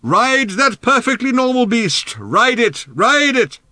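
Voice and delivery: high, drawn-out holler; slow, loud rallying yell; distant shouting. A narrator's reading. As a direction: urge them on at full voice. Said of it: "His distant voice reverberated".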